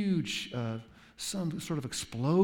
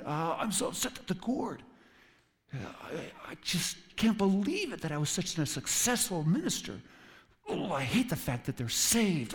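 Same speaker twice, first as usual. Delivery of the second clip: whiny Jonah; 6 semitones higher than usual. Retold or imitated. Jonah sulking over the gourd.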